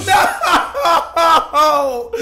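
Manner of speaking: high, loud laughing scream of no; shrieking laughter. Real, not acted. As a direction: cry out laughing in defeat. Eaten by shark.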